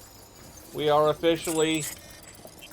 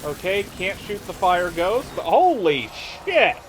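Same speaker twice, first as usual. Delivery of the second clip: excited shout; 5 semitones higher, 6 dB louder than usual. Real, not acted. Fire ghost fight erupts.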